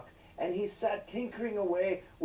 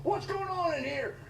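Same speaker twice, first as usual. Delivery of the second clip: mock cop bursting in; 12 semitones higher than usual. Police kicking down the door, hammed-up yell. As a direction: burst in barking like a cartoon cop. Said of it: "the police kicked down the door".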